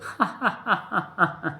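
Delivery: put-on high, childlike giggling; long peal of little-girl laughter. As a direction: keep laughing merrily at him. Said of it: "खिलखिलाकर हंस पड़ी मसाबा".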